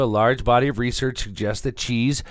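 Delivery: hyped article read. Acted. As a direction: read it out like thrilling breaking news. Reads the article out theatrically.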